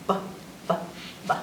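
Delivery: crisp short b sound, repeated; letter sound. Shown as a demonstration. Teaching kids the letter sound, crisp b. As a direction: clip the B sound three times. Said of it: "the sound that the letter B says is".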